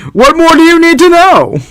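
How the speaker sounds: hammed-up salesman. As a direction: finish the pitch with a flourish.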